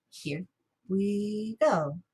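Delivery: drawn-out, measured words; count-in. Shown as a demonstration. Teacher cueing everyone to say it together, drawn-out count-in. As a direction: stretch each word on the beat.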